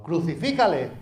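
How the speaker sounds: loud, high shouted demand; crowd shouting. Retold or imitated. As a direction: hurl it like an angry mob. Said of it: "estaban gritando". (grito de la multitud enfurecida)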